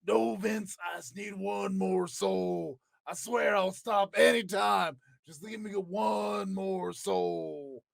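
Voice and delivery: slow pleading Undertaker voice; Undertaker impression. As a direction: beg slowly like an addict. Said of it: "terrible impressions".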